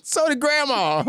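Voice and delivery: squeaky falsetto mourner, hammed up; high mock mourner. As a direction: shriek it like a startled mourner in a high put-on voice. Mocking mourner voice.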